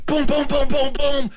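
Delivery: very high-pitched rapid booms; frantic imitated pounding. Boy pounds harder on the lounge door, frantic booms. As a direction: hammer on the door with your voice. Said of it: "You didn't knock loud enough".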